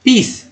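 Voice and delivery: पीस with a long ee, lifted high; पीस modelled for students, long ee; careful model word. Shown as a demonstration. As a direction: stretch the ee in पीस. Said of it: "पीस में ई का उच्चारण ई".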